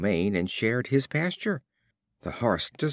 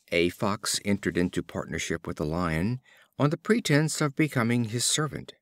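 plain reading voice

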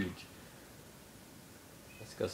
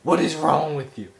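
loud, higher exasperated shout; exasperated yell; 5 semitones higher, 6 dB louder than usual. Real, not acted. Gamer yelling at the game, exasperated. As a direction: yell at the screen in disbelief.

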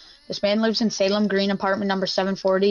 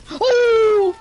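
loud yell; 13 semitones higher, 6 dB louder than usual. Real, not acted. Game reaction.

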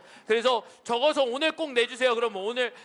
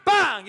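Korean gunshot noise; 3 semitones higher, 5 dB louder, much slower than usual. Shown as a demonstration. Pastor shows how Koreans imitate gunfire, loud bang. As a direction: fire out a Korean-style bang. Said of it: "이런 소리가 나죠".

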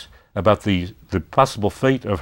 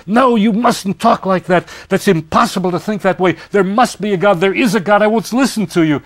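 much higher, louder, outraged protest; Sonya outraged; 11 semitones higher, 5 dB louder than usual. Retold or imitated. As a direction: lash back, refusing to hear it. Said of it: "she virtually attacks him physically, says".

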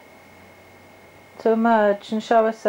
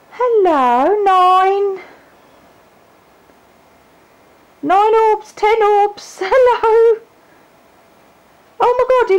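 campy drag queen; 9 semitones higher, 4 dB louder, slower than usual. Acted. Camp coaxing of orbs.

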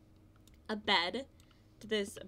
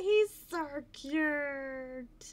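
higher, very slow, louder gushing coo; 5 semitones higher, 6 dB louder, much slower than usual. Real, not acted. Gamer gushing over a cute foal. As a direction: stretch it out in adoration.

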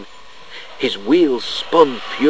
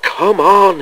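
higher, louder, shivery urging; impatient; 8 semitones higher, 6 dB louder than usual. A narrator's reading. Shivering engine waiting for the whistle, impatient. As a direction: urge it on impatiently, pitched up and forceful. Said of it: "he shivered impatiently".

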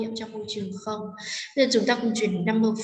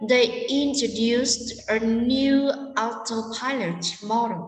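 very slow, stressing "introduced", "new", "autopilot" and "model"; sentence stress; much slower than usual. Shown as a demonstration. Teacher modelling stress for students, slow weight on "introduced", "new", "autopilot", "model". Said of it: "chúng ta sẽ nhấn trọng âm".

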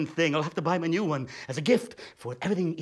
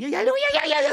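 excited squeal; 5 dB louder, faster than usual. Acted. Excited recognition.

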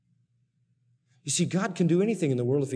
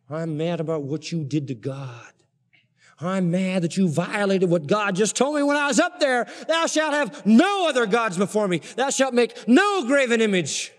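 indignant Moses; 6 semitones higher, 4 dB louder than usual. Acted. Preacher voices angry Moses.

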